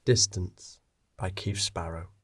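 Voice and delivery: ordinary delivery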